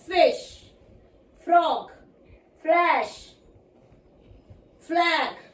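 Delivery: फिश, फ्रॉग, फ्लैश, फ्लैग में फ को ज़ोर की हवा के साथ; हर शब्द अलग से, फ पर हवा छोड़ते हुए; f words demo. Shown as a demonstration. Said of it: "हमारे मुंह से एफ बोलते टाइम साउंड बाहर आएगा". (फ साफ़ सुनाने वाला उच्चारण)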